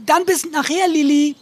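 high, loud cheery farewell. Acted. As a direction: sing out a happy goodbye. Kasper's cheery goodbye.

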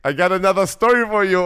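mock German accent, loud; teasing German accent. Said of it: "mit deutschem Akzent".

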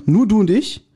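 ordinary delivery

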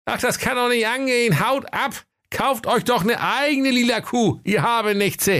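very high, grumpy rich man's voice; Kaiser calling out. Acted. Kaiser chases children from his fence, high indignant cry. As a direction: shoo them off in a squeaky high voice. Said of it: "dann rief er".

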